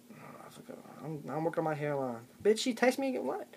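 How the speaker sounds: ordinary talk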